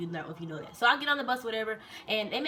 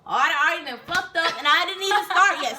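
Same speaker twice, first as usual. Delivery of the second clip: high, loud retold complaint; exasperated dismay; 7 semitones higher, 9 dB louder than usual. Recruit realizing she already messed up, loud exasperation. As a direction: wail it in comic frustration.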